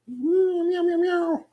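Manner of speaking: very high cat meows; meowing. Pretend.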